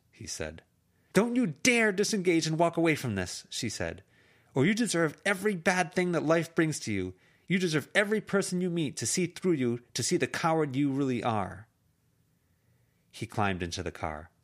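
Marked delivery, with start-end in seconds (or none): 1.1-3.5 high, loud enraged yelling; furious shout. Acted. Screaming at him as he leaves. 4.5-11.7 high, loud cursing tirade; furious shout. Acted. Screaming curses at departing man.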